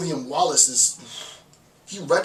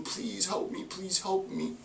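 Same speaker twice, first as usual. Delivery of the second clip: soft, higher half-whispered plea; mock begging; 4 semitones higher, 7 dB quieter, slower than usual. Marginalized person begging the institution, mocked softly. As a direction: beg in a feeble hush. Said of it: "asking and begging".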